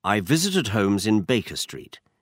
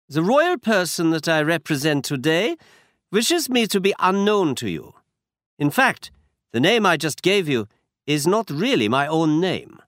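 put-on higher voice with a German accent; a proud Bohemian noble speaking with a German accent; German-accented count; 8 semitones higher than usual. A narrator's reading. Masked count introduces himself, German accent. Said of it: "He had a strong German accent".

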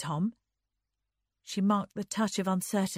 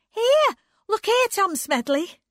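very high, loud call; shrill-pitched interrupting shout; breaking in; 10 dB louder than usual. A narrator's reading. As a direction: burst in to grab his attention. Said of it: "broke in Anne".

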